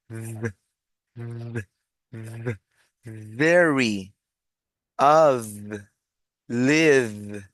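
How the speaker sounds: isolated "v" sounds, then drawn-out model words; articulated V sound. Shown as a demonstration. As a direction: buzz the V in "very, of, live". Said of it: "con esa misma articulación".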